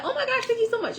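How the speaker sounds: very high excited thank you; flattered reply to the stranger; squealing thanks. Retold or imitated. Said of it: "And I was like".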